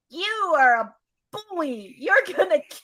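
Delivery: high, slow whiny victim voice; mock whiny voice. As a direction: whine it like a hurt victim. Mocking Carly's messages.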